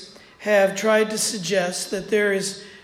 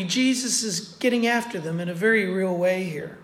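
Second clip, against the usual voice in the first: usual speaking voice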